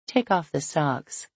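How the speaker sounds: ordinary delivery, nothing marked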